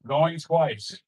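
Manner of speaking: mock auctioneer going once, going twice; auctioneer call. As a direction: call it like an auctioneer closing a lot. Auctioneer-style countdown at event.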